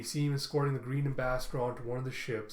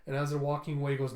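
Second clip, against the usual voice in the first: normal talking voice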